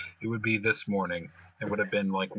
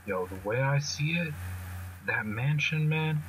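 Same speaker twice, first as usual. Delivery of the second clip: higher, streetwise hype in the voice; higher-pitched street-tough swagger; Spitter's street voice; 4 semitones higher than usual. Retold or imitated. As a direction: talk it up like a swaggering street kid. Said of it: "He was like".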